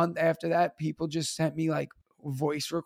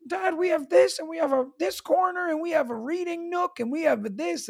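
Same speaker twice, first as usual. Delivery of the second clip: put-on high, excited little-kid voice; imitating his son; 10 semitones higher than usual. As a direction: talk like an eager child showing off his class. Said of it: "my son's like".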